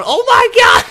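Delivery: loud shocked yell; surprised shout. Real, not acted. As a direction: blurt it out in shock. Shouting at crash, laughing.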